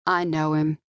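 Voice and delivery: plain reading voice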